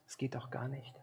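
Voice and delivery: a soft voiceless aside; whispered aside. Acted. Preacher admitting he cannot answer everything, in a whisper. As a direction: let it fall to a whisper.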